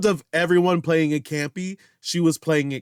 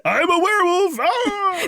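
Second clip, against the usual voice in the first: goofy stoner werewolf roar; Seth Rogen werewolf. Pretend. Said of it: "I love your Seth Rogen".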